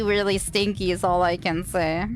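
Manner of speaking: ordinary delivery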